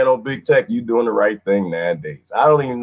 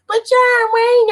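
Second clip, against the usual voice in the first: very high, slow whining objection; squeaky mock complaint; mock protest; 4 dB louder, slower than usual. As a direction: put on a high drawn-out voice. Mocks an objecting commenter.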